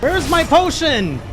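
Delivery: high, slow, strained panic; high drawn-out panic at a boss; panicked cry for a potion. Retold or imitated. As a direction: beg for a healing potion in a pinch. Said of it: "I'm like".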